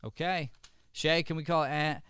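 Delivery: ordinary talk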